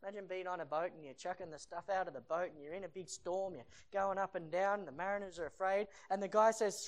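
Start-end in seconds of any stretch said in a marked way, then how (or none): none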